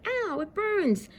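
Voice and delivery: high slow mock yelp; hammed-up high ouch; Earth in pain. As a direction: play the poor little Earth. Earth voiced burning.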